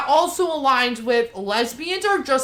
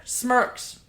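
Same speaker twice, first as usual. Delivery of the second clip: ordinary talk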